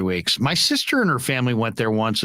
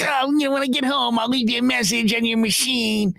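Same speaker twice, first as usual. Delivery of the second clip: much higher-pitched colleague impression; Ralph; 8 semitones higher than usual. Colleague promising to leave a message, high impersonated voice. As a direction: put on the eager tipster's high voice. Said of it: "He's like".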